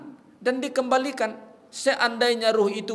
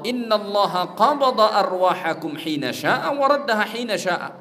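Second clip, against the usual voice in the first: louder, higher solemn Arabic recitation; Arabic hadith; 5 semitones higher, 6 dB louder than usual. Acted. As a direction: proclaim the Prophet's words in Arabic.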